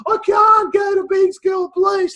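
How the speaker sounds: put-on high, loud child's wail, slow. Little boy begging his parents not to go, high wailing. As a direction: beg your parents through tears. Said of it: "in floods of tears".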